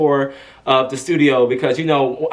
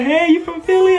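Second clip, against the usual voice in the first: higher-pitched, upbeat retold greeting; excited greeting; 12 semitones higher, 4 dB louder than usual. Singer asks her escort if he's from Philly, imitated excited greeting.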